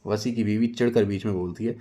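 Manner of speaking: plain reading voice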